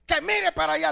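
high, loud order; acted shout. Preacher plays someone ordering the patient to look away, shouting.

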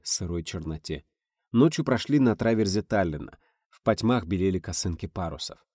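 ordinary delivery

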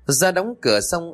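usual speaking voice

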